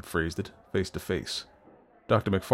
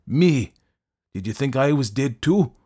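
raised, forceful retort; confronting challenge; 6 semitones higher, 6 dB louder than usual. A narrator's reading. Fettes confronting the doctor, forceful challenge. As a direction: throw it back as a challenge.

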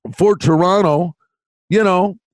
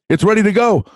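building mock hype; 4 semitones higher than usual. To his co-host about the bet, rising mock hype. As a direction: pump it up like a volcano about to blow.

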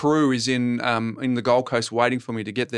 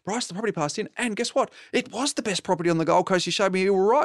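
mock-excited client, pitched higher; 6 semitones higher than usual. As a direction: play the thrilled client gushing the news. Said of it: "the client goes".

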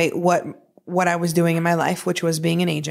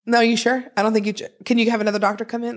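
fast anxious pleading; 4 semitones higher, faster than usual. Retold or imitated. Retold pleading with medics.

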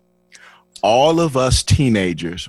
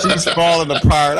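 said through laughter, pitched up; laughing; 9 semitones higher than usual. Real, not acted. Teller cracking up retelling Bethany's panic, laughing. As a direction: giggle the words out.

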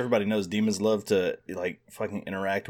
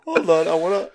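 ordinary delivery

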